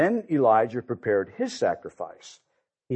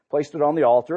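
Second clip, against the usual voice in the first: usual speaking voice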